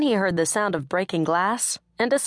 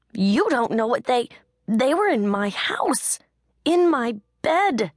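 higher and louder, climbing, slow; building upset, pitch climbing high; rising distress; 7 semitones higher, 3 dB louder than usual. A narrator's reading. Heroine distraught, struggling in his arms.

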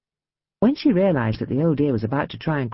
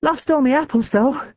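panting; 10 semitones higher, 3 dB louder than usual. A narrator's reading. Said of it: "she panted".

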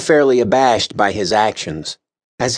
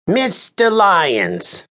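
high, slow, exasperated official; haughty official voiced high; exasperated Lars Kern; 9 semitones higher, much slower than usual. A narrator's reading. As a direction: drag out his name in weary annoyance. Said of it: "began the exasperated Lars Kern".

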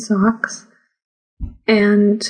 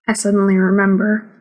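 plain reading voice

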